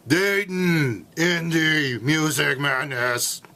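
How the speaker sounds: slow, drawn-out rally announcer; monster truck announcer. Pretend. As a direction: stretch it like a monster truck promo. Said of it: "sounds like I'm at a Monster Truck rally".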